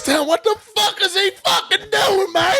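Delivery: high, shouted imitation of a furious wrestler; snapping. Retelling Heath rolling out to the ref, snapping. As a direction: shout it like he's just lost it, voice way up. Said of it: "Like, he snaps".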